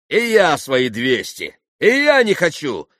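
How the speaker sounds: loud, rising emphatic refusal; exclaimed refusal. A narrator's reading. Mitya joins in refusing the money, exclaiming. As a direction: cry out that you refuse too. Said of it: "воскликнул Митя".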